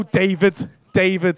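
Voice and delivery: mock introduction. Comic mimics punter introducing himself, mock-deliberate. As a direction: introduce yourself with careful effort, mimicking him. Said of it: "made the effort to go".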